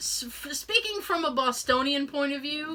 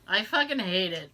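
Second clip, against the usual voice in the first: usual speaking voice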